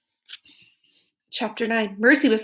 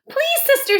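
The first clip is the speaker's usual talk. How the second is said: very high begging plea; pleading Baby; 4 dB louder than usual. Acted. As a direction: beg her in a high little voice.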